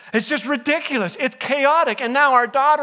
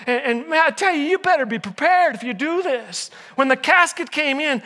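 usual speaking voice

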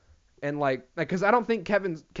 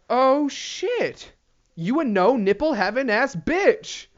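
high, drawn-out comic disbelief; mock shocked outburst; 6 semitones higher, much slower than usual. Comedian acting out seeing no nipples, mock shocked. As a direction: exclaim it, stretching the insult out. Acted shocked reaction in bit.

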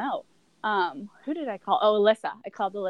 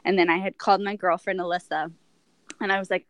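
ordinary talk, nothing marked

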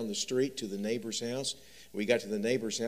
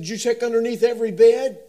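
put-on much higher, louder anxious child voice; scared little boy; 13 semitones higher, 16 dB louder than usual. Frightened son asking his dad about closets, high and loud. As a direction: plead like a frightened kid.

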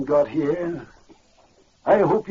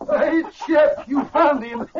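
high, delighted exclamation; joyful surprise; 9 semitones higher, 4 dB louder, much slower than usual. Acted. Blind uncle greeting his lost dog, joyful surprise. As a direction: exclaim at a happy reunion.

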